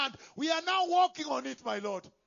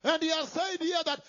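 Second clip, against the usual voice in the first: ordinary talk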